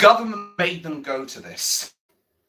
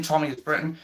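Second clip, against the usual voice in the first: normal talking voice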